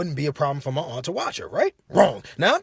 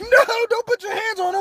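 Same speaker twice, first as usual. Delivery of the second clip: high, loud pleading cry; hammed-up shrill protest; mock alarmed aunt; 5 dB louder than usual. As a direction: wail it to stop him.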